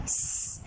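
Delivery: unvoiced स, only the hiss; soft hissed स, no vowel; sound of S. Shown as a demonstration. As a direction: breathe out a sharp स hiss. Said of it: "इनका sound हो जाएगा".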